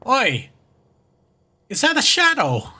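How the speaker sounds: high-pitched mock British; hammed-up British "oi"; bad British accent. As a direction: call out as a silly British bloke. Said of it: "I'm doing a shitty British accent".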